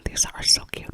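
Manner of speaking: usual speaking voice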